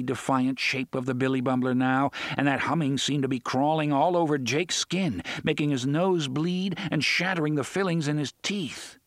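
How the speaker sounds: ordinary delivery, nothing marked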